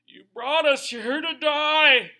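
high, loud, slow wail of protest; complaining shout. Retold or imitated. Israelites at the sea, shouting at Moses. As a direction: howl it like a people accusing their leader. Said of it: "And then they're like".

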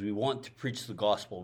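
ordinary talk, nothing marked

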